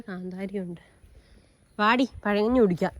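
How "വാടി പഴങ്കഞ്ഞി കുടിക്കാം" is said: calling out. Real, not acted. Calling daughter to eat.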